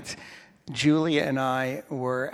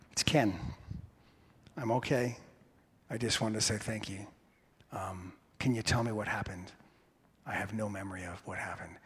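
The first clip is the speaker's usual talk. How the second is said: quiet injured voice; 6 semitones lower, 12 dB quieter than usual. Retold or imitated. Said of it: "I get a phone call".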